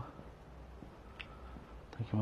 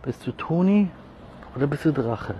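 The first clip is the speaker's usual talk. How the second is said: high pet talk; 4 semitones higher, 4 dB louder than usual. Real, not acted. High question to cat.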